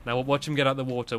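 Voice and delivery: ordinary talk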